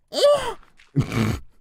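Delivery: very high loud shriek of laughter; squealing laugh. Real, not acted. Streamer cracking up at own meme joke, shrill laugh.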